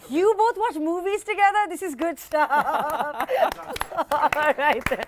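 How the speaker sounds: words through real laughter; amused giggling while asking; laughing. Real, not acted. Host laughs at contestants' answer.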